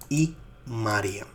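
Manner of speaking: normal talking voice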